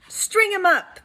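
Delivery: high, loud angry shout. A narrator's reading. Angry townsmen shouting to punish Anthony. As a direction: bark it up high like an angry mob. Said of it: "the men of the town shouted".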